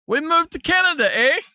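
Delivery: playful mock Canadian accent with "eh". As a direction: poke fun with a Canadian accent. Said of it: "it kills me, people saying".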